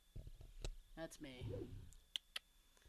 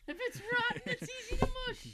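much higher, louder, fast mimicking voice; mocking high squeaky imitation; 14 semitones higher, 5 dB louder, faster than usual. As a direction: parrot it back in a silly high voice. Mocking repeat of partner.

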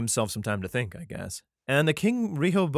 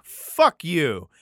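mock insult; 4 semitones higher, 7 dB louder than usual. Mock king insulting the people.

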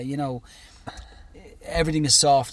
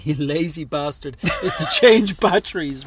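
laughing; 6 dB louder, slower than usual. Real, not acted. Teasing while laughing.

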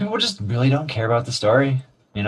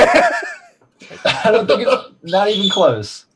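laughing; 8 semitones higher, much slower than usual. Real, not acted. Laughing disbelief.

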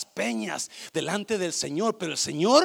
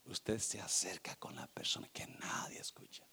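much softer, voiceless whisper; 10 semitones lower, 16 dB quieter than usual. Shown as a demonstration. Preacher demonstrating a whisper to the congregation. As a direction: say it close, like a secret. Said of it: "Cuando usted le susurra".

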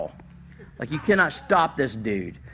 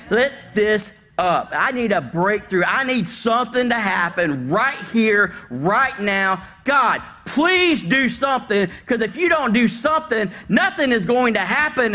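desperate begging prayer; 8 semitones higher, 4 dB louder than usual. Pretend. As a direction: beg God like it is your last chance.

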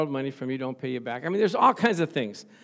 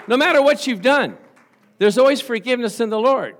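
higher, louder preaching cry; fervent preaching; 7 semitones higher, 10 dB louder than usual. Acted. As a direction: lift the room with assurance. Preacher building to climax.

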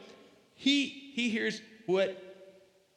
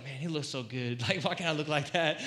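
mock jealousy; 4 semitones lower than usual. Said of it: "he's not, like, jealous".

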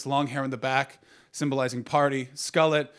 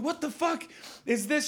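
high incredulous mock driver; high, baffled onlooker voice; gawking driver; 13 semitones higher than usual. Drivers gawking at roadside test.

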